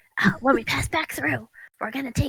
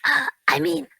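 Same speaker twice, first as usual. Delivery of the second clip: ordinary delivery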